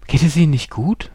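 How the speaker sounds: a worried passerby asking; concerned stranger. Retold or imitated. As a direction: call out in concern. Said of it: "fragt jemand".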